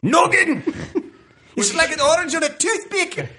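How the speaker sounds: mock Scottish dad yelling; Scottish dad impression. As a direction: shout it like an angry Scottish father. Quoting Mike Myers Scottish dad.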